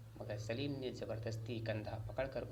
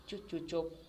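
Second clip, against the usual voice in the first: scolding; 5 semitones higher than usual. A narrator's reading. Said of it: "अब्बा डांटते डांटते रुक गए।".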